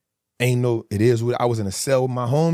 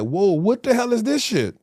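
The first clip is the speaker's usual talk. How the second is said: much higher, stunned disbelief; high, bewildered shock; 9 semitones higher than usual. Retold or imitated. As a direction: act floored by the outside world. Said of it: "I'm like".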